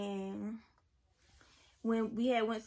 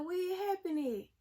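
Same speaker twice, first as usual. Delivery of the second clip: much higher, prying grandma voice; shocked grandma; 9 semitones higher than usual. Retold or imitated. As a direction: push up into grandma's incredulous question. Imitating grandma's shocked questions.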